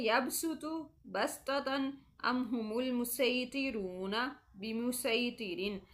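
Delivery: slow chanted Arabic words; tajweed recitation of sad and seen words; Quranic recitation. Shown as a demonstration. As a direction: recite the four words slowly and clearly. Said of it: "चार कलमात है".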